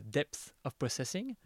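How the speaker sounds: slow English term in a French accent. Real, not acted. Interviewer spelling out an acronym for her guest, French-accented English. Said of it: "mon accent anglais, euh, va rentrer en, en scène".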